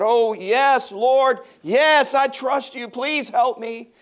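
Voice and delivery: high, loud begging; desperate pleading. Retold or imitated. Man dangling from a cliff begs God, high and loud. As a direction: cry out to the Lord in panic.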